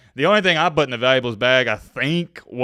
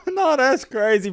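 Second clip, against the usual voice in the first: words still riding a laugh, higher and slower; 11 semitones higher, slower than usual. Real, not acted. Host reacting to the stolen-chain clip, laughing through it. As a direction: talk through the last of the laughter. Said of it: "I'm not laughing at it".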